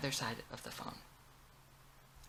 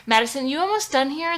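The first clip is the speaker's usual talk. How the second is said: hurried call; 10 semitones higher, 11 dB louder than usual. Acted. Stylist rushing up.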